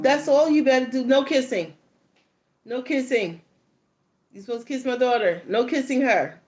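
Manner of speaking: high, loud bossy mom rules; stern warning. Retold or imitated. Mom warning the boy, no kissing, bossy and loud. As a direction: lay down the law.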